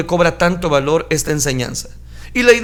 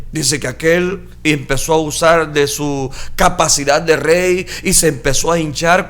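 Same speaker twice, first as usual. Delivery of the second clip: ordinary delivery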